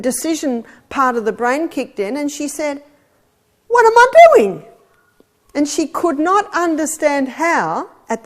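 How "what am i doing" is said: high, loud burst of surprise; an exclaimed "what am I doing", high and loud; sudden realisation. Retold or imitated. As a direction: blurt it as if waking up mid-run.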